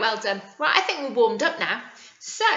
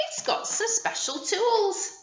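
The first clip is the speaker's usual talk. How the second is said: higher, slow, awed delivery; wonder; 8 semitones higher than usual. Pretend. Teacher revealing special tools to children, hushed wonder. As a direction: reveal it slowly like a treasure. Showing children the toolkit's contents.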